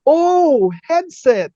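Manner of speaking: high, loud, sugary realisation; suddenly nice. Retold or imitated. Stewardess acknowledging the passenger's request, exaggeratedly nice. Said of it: "iba ng face niya".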